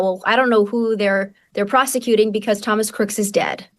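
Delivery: usual speaking voice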